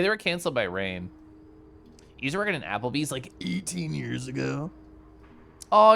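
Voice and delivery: ordinary talk, nothing marked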